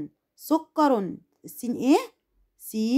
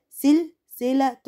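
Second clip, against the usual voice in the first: slow syllable reading; 2 semitones higher, much slower than usual. Shown as a demonstration. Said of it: "السين مع اللام ساكن مع اللي قبله".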